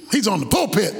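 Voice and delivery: usual speaking voice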